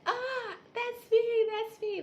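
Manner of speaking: high, slow, very loud delighted squeal; drawn-out thrilled cry of recognition; excited squeal. Retold or imitated. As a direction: squeal it out like you just found yourself. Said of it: "And I'm like".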